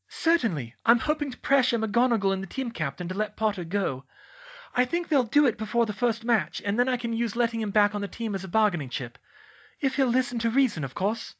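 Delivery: high, quick put-on character voice; scheming Dolores. A narrator's reading. Voices Dolores explaining her scheme.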